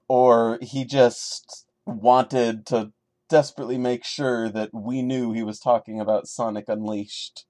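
ordinary delivery, nothing marked

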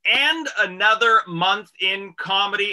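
slow, upbeat announcer read; radio host intro. Acted. Host opening the radio show, announcer voice.

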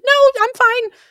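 high, loud mock "no thanks" voice; polite brush-off. Interviewee waving off the offer of water, mock high refusal. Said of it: "You don't say".

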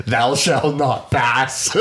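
slow, drawn-out wizard proclamation; hammed-up wizard, on the edge of laughter; Gandalf. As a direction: declaim it as a mock wizard. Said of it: "It was very Gandalf".

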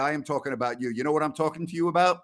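usual speaking voice

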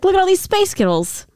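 high-pitched mock Scottish; mock Scottish accent. Shown as a demonstration. Host demonstrating a sound-alike for co-host, mock Scottish. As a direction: put on a high Scottish lilt. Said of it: "that is very Scottish".

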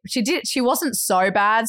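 plain reading voice

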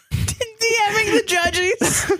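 very high, slow words through laughter; laughing high. Real, not acted. Joking about messaging the judges, high and laughing. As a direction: squeak it out while laughing.

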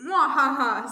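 loud, theatrical cackle; evil villain laugh. A narrator's reading. Phone villain revealing the task, gloating cackle. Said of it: "says the voice on the phone".

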